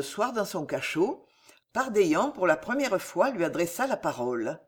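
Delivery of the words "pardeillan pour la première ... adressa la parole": ordinary delivery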